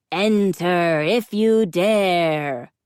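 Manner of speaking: loud, slow, grand wizard welcome; theatrical wizard. A narrator's reading. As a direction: boom it out like a stage wizard. Costumed wizard greets grandly.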